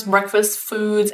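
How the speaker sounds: ordinary talk, nothing marked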